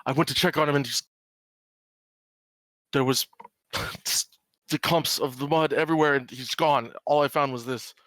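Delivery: father explaining that his son vanished, mildly upset; lightly voiced distraught father. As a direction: let the worry show, but only slightly. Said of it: "he shakes his head and he".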